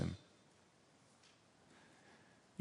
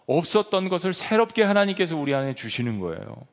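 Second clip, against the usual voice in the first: ordinary delivery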